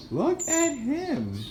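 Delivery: very high, slow 'Look at him'; amused pet talk. Real, not acted. Pointing out bird Juan.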